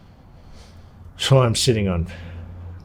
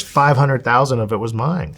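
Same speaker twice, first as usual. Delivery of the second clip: ordinary delivery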